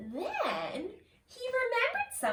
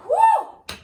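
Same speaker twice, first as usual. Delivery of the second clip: relieved whew; 13 dB louder than usual. Acted. Fire put out.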